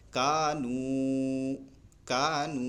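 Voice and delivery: کانو recited slowly with the long a held; slow recited demo; stretched a. Shown as a demonstration. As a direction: draw out the a of کانو. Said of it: "پڑھیں گے".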